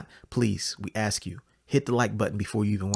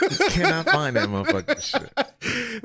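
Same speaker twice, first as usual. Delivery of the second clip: slow, loud laugh through words; 5 dB louder, slower than usual. Real, not acted. Laughing at friend's gym trouble.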